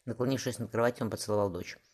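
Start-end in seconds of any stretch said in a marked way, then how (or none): none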